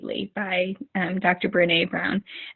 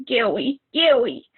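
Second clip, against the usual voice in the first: a light imitation of a toddler lisping scary, higher; 4 semitones higher, slower than usual. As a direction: mimic a little son pointing at something. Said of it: "point at it and say".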